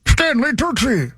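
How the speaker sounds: slow booming game-show announcer. Acted. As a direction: stretch the name like an announcer. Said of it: "now that sounds more like, like Don Pardo".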